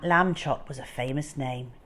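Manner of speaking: usual speaking voice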